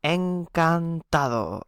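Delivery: teacher modelling "encantado" slowly; Spanish encantado. Shown as a demonstration. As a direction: draw out "encantado" for the class. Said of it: "You can say encantado".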